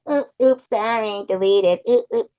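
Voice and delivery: high, loud fake-innocent squeak; mock oopsie. Streamer mimicking someone who deleted messages, mock-innocent. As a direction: mimic a put-on "oops, silly me" in a high voice.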